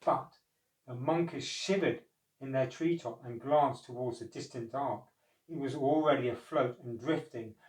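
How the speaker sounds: ordinary delivery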